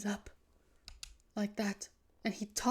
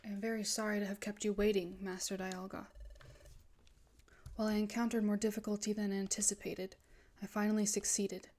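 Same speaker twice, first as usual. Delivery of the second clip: low-key, soft-spoken sinister servant; obedient villain; 3 semitones lower, 7 dB quieter, faster than usual. Acted. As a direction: report to your master with cold, measured deference. Voices villain reporting to Master Dialga.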